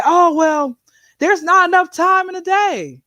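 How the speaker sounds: high-pitched imitation of an excuse-maker; mock excuse. Speaker mimics people claiming they are too busy, mock excuse. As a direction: put on a high, complaining excuse. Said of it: "You can't always say".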